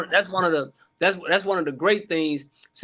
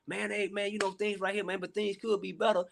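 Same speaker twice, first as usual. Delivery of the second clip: hushed friend confiding; 10 dB quieter than usual. Retold or imitated. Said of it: "start opening up and say".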